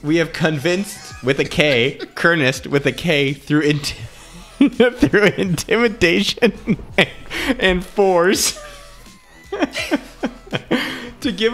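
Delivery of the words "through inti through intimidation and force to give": breaking into laughter mid-word; trying to read through laughter; cracking up while reading; laughing. Real, not acted.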